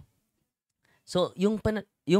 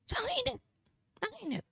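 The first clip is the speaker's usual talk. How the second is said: much higher, fading anguished yelp; pained cry of heat; 6 dB quieter, faster than usual. Retold or imitated. Possessed husband complaining the oil burns, pained. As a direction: whimper as if scalded. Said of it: "Ang sabi niya sa kanyang asawa".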